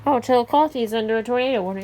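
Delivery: ordinary talk, nothing marked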